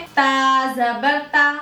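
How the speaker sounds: ता spoken slowly and thin, with a light tongue; careful Quran letter drill; slow letter demo. Shown as a demonstration. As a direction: sound out ता जबर ता slowly, light and fine. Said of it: "ध्यान से सुनिए".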